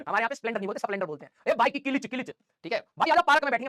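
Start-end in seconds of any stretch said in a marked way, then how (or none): 1.5-2.3 किलिच said as ki-lich with an extra vowel, village style; local लहजे में किलिच; local dialect word. Shown as a demonstration. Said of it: "bike का क्लच नहीं होता, किलिच होता है". 3.0-3.7 louder village-dialect call to a friend in Haryanvi style; mock Haryanvi friend calling out; local Haryanvi-style dialect. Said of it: "पार्क नहीं बोलते".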